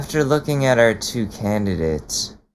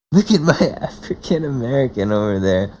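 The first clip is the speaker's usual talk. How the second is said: laughing quote. Retold or imitated. Laughing while repeating clip's line.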